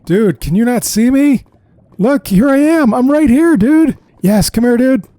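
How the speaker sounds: high, loud frantic calling; frantic shout. Real, not acted. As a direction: yell for him to notice you. Calling to mount from the lava.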